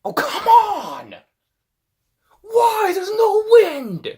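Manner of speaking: high, loud, drawn-out frustrated yell. Real, not acted. Gamer yelling at her failed throw, frustrated. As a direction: wail at the bad luck.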